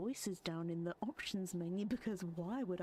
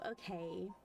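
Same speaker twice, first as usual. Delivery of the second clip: usual speaking voice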